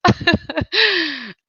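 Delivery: high, helpless laugh; high-pitched cracking-up; real laughter. Real, not acted. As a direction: dissolve into giggles. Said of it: "making me laugh".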